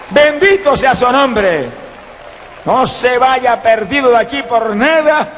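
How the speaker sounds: high-pitched, driving preacher's appeal; fervent revival exhortation; urgent altar call. Real, not acted. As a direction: plead with the crowd at full force, rising to praise.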